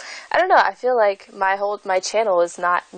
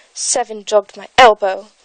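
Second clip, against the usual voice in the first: sulky; 7 dB louder than usual. Acted. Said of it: "said Five in a sulky tone".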